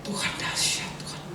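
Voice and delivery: whispered amazement; soft, breath-only exclamation of wonder; hushed awe. Retold or imitated. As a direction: whisper it in awe, as retold to a wife. Retells awed words to his wife.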